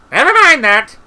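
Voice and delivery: loud, very high brush-off; shouted interruption. A narrator's reading. Wizard cutting Treatle's objection off, high shout. Interrupting, first take then redone.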